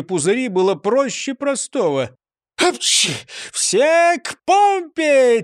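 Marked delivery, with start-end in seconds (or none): 2.6-3.1 spelt-out "achoo" sneeze; mock sneeze. A narrator's reading. Нисычих sneezes beside the fire pump, acted sneeze. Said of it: "чихнув". 3.5-5.4 high, drawn-out rallying cry; laughing call. A narrator's reading. Нисычих calls the gang to the pump, gleeful high call. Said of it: "рассмеялся Нисычих".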